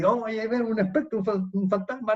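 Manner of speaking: high, panicked shout of men seeing a ghost; frightened cry. Acted. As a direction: cry out in terror at the spectre.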